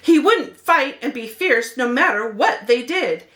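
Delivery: usual speaking voice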